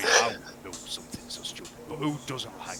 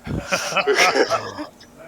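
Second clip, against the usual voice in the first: loud burst of laughter; 8 semitones higher, 14 dB louder than usual. Real, not acted.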